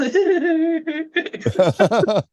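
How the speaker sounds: high, muffled giggle imitation; imitated stifled laugh. Imitating Miss Woods laughing into her hand. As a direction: mimic a laugh hidden behind a hand. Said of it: "not so much laughter, but this".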